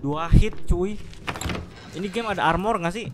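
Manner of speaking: agitated loud. Real, not acted. Taking heavy damage.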